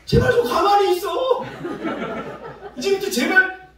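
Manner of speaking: high, very loud mock outburst at nagging kids; exasperated scolding shout. Pastor telling restless kids to keep still, exasperated shout. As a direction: yell as if at your wits' end.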